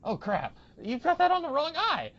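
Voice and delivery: high, loud pretend alarm at the wrong eye; mock exclamation. Friend playacts a character yelling about the eye patch in mock alarm. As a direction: shout it as if you just spotted the mistake.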